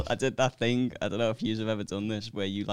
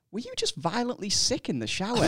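imitating her, high and laughing; a high, giggly quoted question; high-pitched girl voice; 12 semitones higher than usual. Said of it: "these were her exact words".

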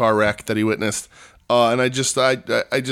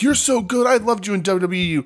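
gushing fans; 9 semitones higher than usual. Retold or imitated. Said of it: "people are like".

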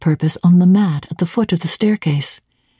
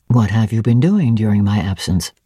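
a hint of a lower, stern master; lightly voiced Rochester; 7 semitones lower than usual. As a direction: drop a little lower for the gruff master.

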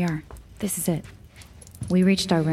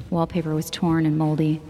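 ordinary delivery, nothing marked

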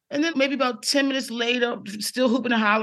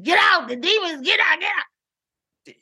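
urgent shout; 8 semitones higher than usual. Retold or imitated. Grandmother shooing them from door.

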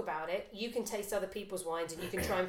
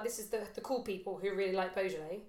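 ordinary delivery

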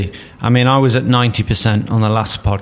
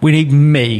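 mock paraphrase; 4 semitones higher, 4 dB louder than usual. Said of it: "I think he's sort of saying".

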